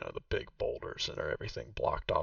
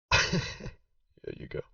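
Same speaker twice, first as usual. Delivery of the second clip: laughing; 12 semitones higher, much slower than usual. Real, not acted. GM laughing mid-scene.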